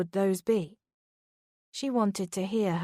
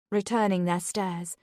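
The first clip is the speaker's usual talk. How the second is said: ordinary delivery, nothing marked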